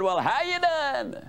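high, eager greeting; hearty, high-pitched hello; very enthusiastic. Retold or imitated. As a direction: greet him with upbeat gusto, voice swooping up. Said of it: "with a lot of enthusiasm".

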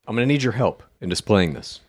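normal talking voice

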